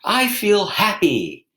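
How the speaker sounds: high, loud call upstairs; calling out happily. Shown as a demonstration. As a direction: sing it out to the floor below. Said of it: "we might call out".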